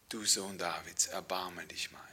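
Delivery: soft whisper, fading away; whispered plea. Acted. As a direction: breathe the plea quietly. Recalled cry now whispered.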